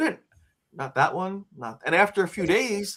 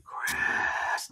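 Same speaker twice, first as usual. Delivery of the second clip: whispered name; 7 dB quieter than usual. Retold or imitated. Unseen voice calls Chris in his dorm, whispered.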